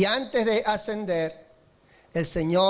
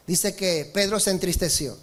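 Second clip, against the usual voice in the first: ordinary talk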